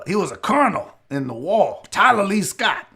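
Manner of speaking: put-on old-time Southern colonel accent; Southern aristocrat. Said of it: "it's a Southern aristocrat".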